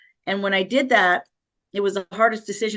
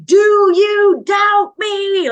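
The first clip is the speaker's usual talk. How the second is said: high, slow, furious scream; screaming threat; 10 semitones higher, 9 dB louder, much slower than usual. Retold or imitated. Abuser screaming at his wife on the floor, high, slow roaring scream. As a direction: scream it slowly like a man out of control. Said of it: "And he's screaming".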